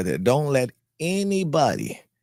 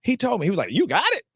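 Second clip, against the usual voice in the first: astonished questions; 13 semitones higher than usual. Retold or imitated. Agent's guy asking, amazed.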